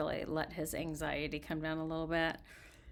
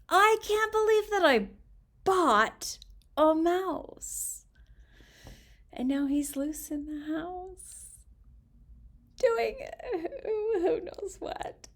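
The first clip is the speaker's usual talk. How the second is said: high, slow exasperated mother voice; fretting mother; 12 semitones higher, 5 dB louder, slower than usual. Acted. Mother lamenting the loose mouse, high slow exasperated voice. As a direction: wail it like a fed-up mom.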